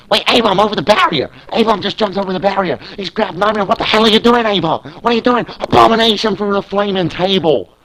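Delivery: hoch, laut und schnell, völlig außer sich; brüll die Fassungslosigkeit über den brennenden Tisch heraus; aufgeregtes Schreien. Acted. Commentator shocks at flaming table.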